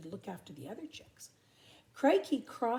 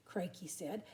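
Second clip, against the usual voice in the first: usual speaking voice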